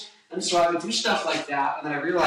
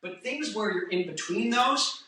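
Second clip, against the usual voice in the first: usual speaking voice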